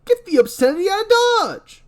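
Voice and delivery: high, loud barking orders; bossy shouting character. Acted. Boss yelling at Michael to go work. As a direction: shoo him off to do his job.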